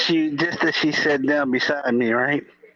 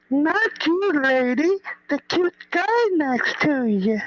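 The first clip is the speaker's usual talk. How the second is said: high, slow and teasing; mock flirty correction; 12 semitones higher, slower than usual. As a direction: set her straight in a high flirty voice. Correcting who he meant.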